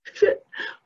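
choked laugh through tears; a wet, broken laugh; laughing while crying; teary laugh. Real, not acted. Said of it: "That doesn't sound good".

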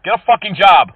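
loud, forceful scolding; angry yell. Real, not acted. Prank caller berating a fake support agent, angry yell. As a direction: berate them with a loud order.

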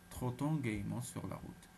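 ordinary delivery, nothing marked

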